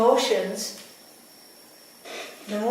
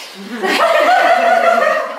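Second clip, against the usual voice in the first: loud, high falling laugh; 14 dB louder than usual. Real, not acted. Storyteller still laughing at the memory, high laugh. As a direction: laugh out loud and let it fall away.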